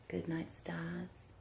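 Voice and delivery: slow whispered goodnight. Acted. Teacher whispering the book's ending to the class. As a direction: breathe it out slowly. Whispered line in bedtime read.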